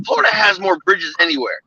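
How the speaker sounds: usual speaking voice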